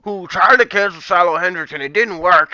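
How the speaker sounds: sneering mock voice of the cancel crowd; a put-on voice mocking critics; mocking pretender. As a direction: parody the people he disagrees with. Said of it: "you're pretending".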